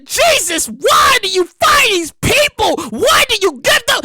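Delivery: high, drawn-out plea of disbelief; exasperated wail. Real, not acted. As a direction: wail the question to heaven. Wailing in disbelief at the story.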